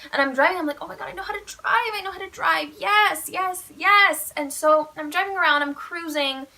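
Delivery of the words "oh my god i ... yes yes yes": giddy amazement. Retold or imitated. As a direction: marvel at yourself, rising to triumphant yeses. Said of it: "I'm doing everything surprisingly well".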